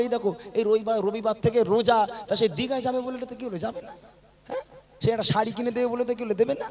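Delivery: lifted, mock-pleading voice; nagging wives' demands. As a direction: mimic a wife pestering for treats. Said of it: "আমার মায়েরা বলবে".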